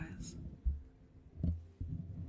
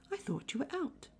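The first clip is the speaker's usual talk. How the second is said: Piglet; 9 semitones higher than usual. Acted. Piglet voiced in read story.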